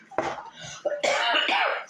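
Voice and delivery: short, breathy cough; cough, then excuse yourself. Real, not acted. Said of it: "Excuse me".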